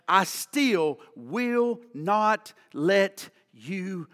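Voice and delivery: one word at a time, spaced out; very slow, resolute promise; emphatic slow vow. Retold or imitated. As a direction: draw out each word with weight. God's vow, drawn out word by word.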